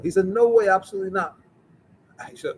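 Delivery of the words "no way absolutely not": retold shouting. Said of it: "He's shouting".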